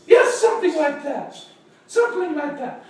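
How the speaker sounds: high-pitched character reply; lady client. Acted. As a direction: play the dog's owner agreeing. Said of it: "She said".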